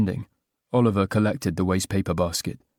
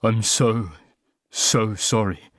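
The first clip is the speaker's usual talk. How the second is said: voice breaking; slower than usual. Acted. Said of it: "voice breaking".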